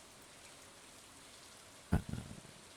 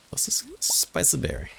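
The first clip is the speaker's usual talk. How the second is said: put-on Italian accent; 9 semitones higher than usual. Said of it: "It's my terrible Italian accent".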